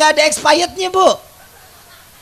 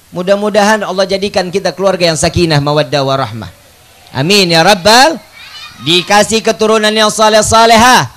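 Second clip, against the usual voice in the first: normal talking voice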